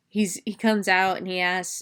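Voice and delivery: ordinary talk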